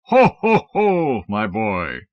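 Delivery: a slow, rolling Santa laugh. Acted. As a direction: Santa greeting a boy from his throne. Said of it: "he was saying".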